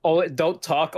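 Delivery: normal talking voice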